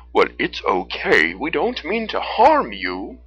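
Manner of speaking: loud, slow, friendly bear voice; deep, friendly bear. A narrator's reading. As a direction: reassure them as a big, friendly bear. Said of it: "in a deep yet friendly voice".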